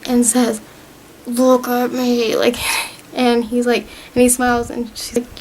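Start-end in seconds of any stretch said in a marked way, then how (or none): 1.3-2.3 drawn out slowly, each word weighted; slow it down to make him listen; slow, firm plea. Retold or imitated. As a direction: hands on his face, slow and deliberate. And she grabs his face.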